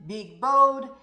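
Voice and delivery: ordinary delivery, nothing marked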